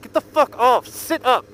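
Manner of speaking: put-on security guard orders the girl off, loud and stern. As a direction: bark it like a fed-up security guard. Ken pulling her off, ordering.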